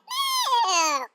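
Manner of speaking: long, slow drawn-out moo; cow mooing. Acted. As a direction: stretch it out like a cow. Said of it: "What does the cow do?".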